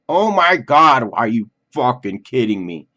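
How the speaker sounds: a retold outraged outburst at the cancellation; exasperated outburst. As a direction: let the frustration burst out. Expectation crushed, inner outburst.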